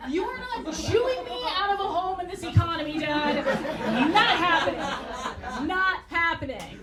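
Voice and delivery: hammed-up laughing insistence, slowing on not happening; mock desperate plea. Comic telling his dying dad not to die: loud laughing plea. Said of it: "I'm like".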